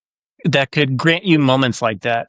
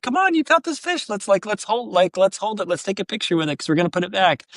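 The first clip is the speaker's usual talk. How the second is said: much higher and softer, sweet encouraging; coaxing; 6 semitones higher, 4 dB quieter than usual. Retold or imitated. A dad urging his scared daughter to hold the fish, soft coaxing. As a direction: coax a scared child gently.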